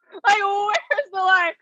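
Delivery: high, drawn-out laughing cry; laughing wail. Retold or imitated. Repeating her retort to the cyberbullying charge, laughing wail. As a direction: wail it slowly through laughter.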